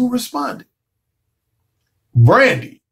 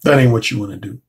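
ordinary delivery

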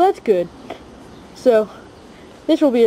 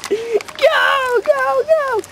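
much higher, urging them on; excited cheer; much slower than usual. Real, not acted. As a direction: cheer the medics to hurry. Cheering the medics.

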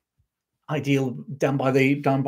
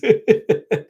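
loud, high burst of laughter; real laugh; 9 semitones higher, 7 dB louder than usual. Real, not acted. Laughs at viewer's story.

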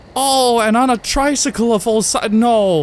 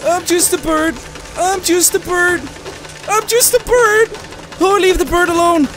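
high, pleading bird voice; pretending to be bird; 5 semitones higher than usual. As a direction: plead innocence as a little bird, growing higher. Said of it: "Pretend to be a bird".

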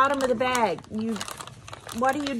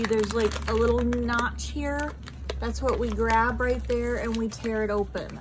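usual speaking voice

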